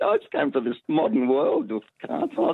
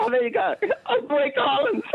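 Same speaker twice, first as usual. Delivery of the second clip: high laughing relief; delighted laugh. Real, not acted. Caller laughing with joy at getting the category right. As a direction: laugh through the words, thrilled.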